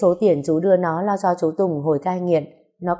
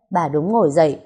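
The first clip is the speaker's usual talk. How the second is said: ordinary delivery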